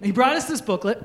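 ordinary talk, nothing marked

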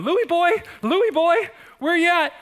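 high, loud sing-song cat call; calling out to Louie the cat on hands and knees. Retold or imitated. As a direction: coax a lost cat out of hiding. Crawling around calling lost cat.

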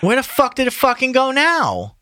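loud, high exasperated outburst; real frustration at the lost page; frustrated. Real, not acted. Reader losing page on Kindle, loud and frustrated. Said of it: "God damn it, this fucking Kindle".

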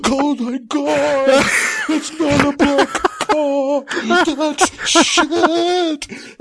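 slow high laughing disbelief; laughing horror. Real, not acted. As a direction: stretch it out high as you crack up. Horrified realization.

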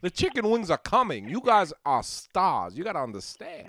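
lilting Walken impression with stretched words; Christopher Walken. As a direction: explain it in a hammed-up celebrity impression. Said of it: "the owner looks like Christopher Walken".

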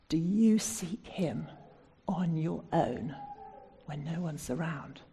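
whispered, slow intimate question; hushed. Real, not acted. Preacher to congregation, a slow hushed whisper. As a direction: lean in and breathe it to the congregation.

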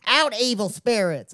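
high, slow mock exorcism; theatrical Southern exorcist; Zelda Rubinstein. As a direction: banish the spirits in a high twang. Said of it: "impression of Zelda Rubinstein".

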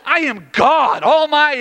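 loud, high thundering declaration; God. Acted. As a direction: proclaim it with indignant authority. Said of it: "He's like".

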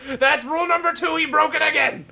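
loud, high, playful accusation; mock accusation shout. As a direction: call the foul out loud.

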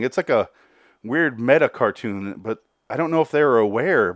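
ordinary delivery

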